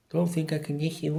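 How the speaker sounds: high, whiny mock of the commenter; mimicking a whiny critic. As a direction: sneer it back in a silly voice. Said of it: "Not sure I can listen to this guy".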